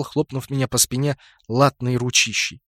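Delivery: ordinary delivery, nothing marked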